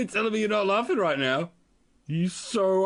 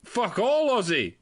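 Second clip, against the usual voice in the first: ordinary delivery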